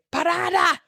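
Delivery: high, sudden shout; yelled call. Retold or imitated. Riders yelling to the bus driver for a stop, shouted. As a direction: call out sharply, pitched right up. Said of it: "you would just yell".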